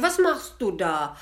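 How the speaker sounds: loud indignant demand; angry devil. Acted. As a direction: snap at her for waking you. Devil woken by pain.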